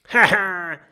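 short, loud, high ha-ha laugh; grinning laugh. A narrator's reading. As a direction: laugh with a grin at the captain's nose. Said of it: "grinst Jim".

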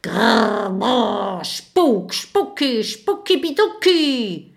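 growled, rising ghost chant, loud; angry ghost hiss. Acted. Angry ghost hissing nonsense at the frightened friends. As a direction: snarl it out like a cross little ghost. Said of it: "Wütend schwebt die Gestalt ... und zischt".

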